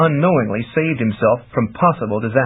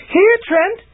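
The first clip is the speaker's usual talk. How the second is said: high, louder call to Trent; crying out; 3 dB louder than usual. A narrator's reading. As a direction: summon him across the wood. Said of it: "she cried again".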